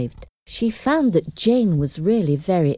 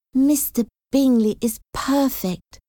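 high, admiring exclamation; delighted exclamation; 9 semitones higher than usual. A narrator's reading. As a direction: exclaim it with raised pitch, full of admiration. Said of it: "Jane exclaimed".

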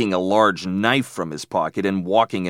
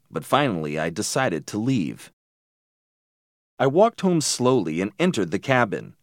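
usual speaking voice

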